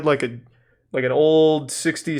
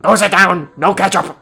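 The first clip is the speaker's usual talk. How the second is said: louder, higher mean cafeteria lady; gruff lunch lady; 4 semitones higher, 7 dB louder than usual. Retold or imitated. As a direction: bark it like a grumpy lunch lady. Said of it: "she does kinda sound like a mean lunch lady".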